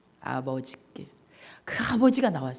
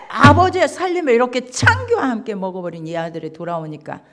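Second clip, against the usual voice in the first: rising, louder angry complaint; protesting son; 5 dB louder, faster than usual. Acted. Elder son angrily protesting to his father, building up. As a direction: argue back, building to a near shout. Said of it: "이렇게 따지는 거예요".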